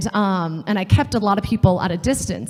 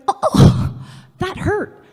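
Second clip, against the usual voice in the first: mock hurt; 11 semitones higher, 5 dB louder, much slower than usual. Stung by supervisor's remark.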